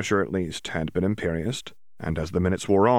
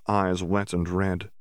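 ordinary delivery, nothing marked

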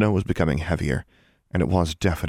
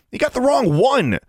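high, louder annoyed snap; 4 dB louder than usual. A narrator's reading. Larry snaps at his friends, annoyed. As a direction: bark it at your friends. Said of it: "Larry snapped".

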